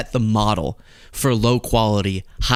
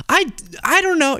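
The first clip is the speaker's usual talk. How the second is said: surprised. Real, not acted. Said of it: "surprised at question".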